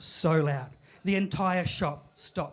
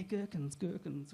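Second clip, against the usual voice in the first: quiet mumble; 9 dB quieter, slower than usual. Retold or imitated. Hinting to his wife under his breath. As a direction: mumble gherkins, much softer. Mumbling hint about gherkins.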